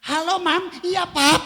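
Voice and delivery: high, loud sweet calling. Retold or imitated. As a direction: call out lovingly across the house. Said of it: "Saya sudah teriak".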